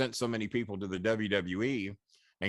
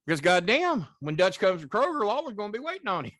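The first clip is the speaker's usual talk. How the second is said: excited retelling; 10 semitones higher, 5 dB louder than usual. Real, not acted.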